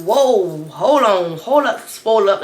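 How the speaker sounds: loud startled whoa-hold-on; mock alarmed loud protest; alarmed pushback. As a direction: back him off fast. Pushing him away.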